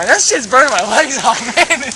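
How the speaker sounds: loud, high-pitched whine; whining. Real, not acted. Friend at the fire complaining his legs burn, loud whining. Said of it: "Quit your whining".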